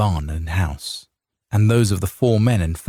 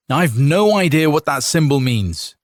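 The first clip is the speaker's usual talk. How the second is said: defiant; 7 semitones higher, 3 dB louder than usual. A narrator's reading. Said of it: "Ricard Eliodum said".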